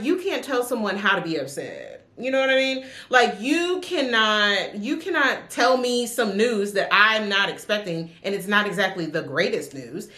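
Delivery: ordinary talk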